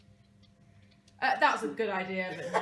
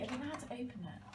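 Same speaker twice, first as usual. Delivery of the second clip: ordinary talk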